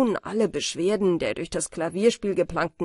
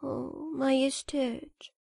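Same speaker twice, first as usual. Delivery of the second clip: softer, slow and dejected; downcast; 5 semitones higher, 7 dB quieter, slower than usual. Acted. Ruined writer addresses the king, softly dejected. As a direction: sink into the words, beaten down. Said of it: "sah sehr niedergeschlagen aus".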